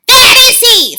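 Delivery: high, loud sharp witch voice; witch snapping. A narrator's reading. Grand High Witch snapping while searching the platform. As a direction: snap it impatiently. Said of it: "snapped the Grand High Witch".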